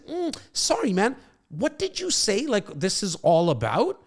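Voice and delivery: confused client. Acted. Role-plays confused client Bo.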